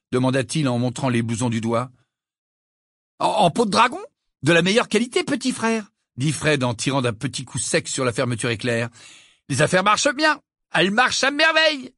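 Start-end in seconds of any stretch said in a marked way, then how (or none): 3.2-5.9 boastful. Acted. Twin shows off jacket. 9.5-11.9 boastful. Acted. Twin boasting about business.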